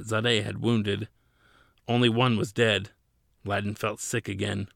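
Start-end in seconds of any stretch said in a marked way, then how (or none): none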